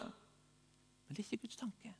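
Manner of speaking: slow, drawn-out whisper; hushed rebuttal. Real, not acted. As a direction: drop suddenly to a whispered correction. Hushed rebuttal after busy striving.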